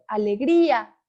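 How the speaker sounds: alegría with a loud, leaned-on GRÍ; stress on GRÍ. Shown as a demonstration. Teacher showing where the accent goes, stressed GRÍ in alegría. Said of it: "hago más énfasis".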